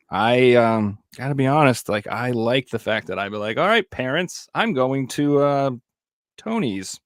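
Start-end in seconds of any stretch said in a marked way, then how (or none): 3.6-7.0 put-on breezy high kid voice calling out; carefree kid. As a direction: toss it off like a teen leaving. Kid telling parents he's leaving.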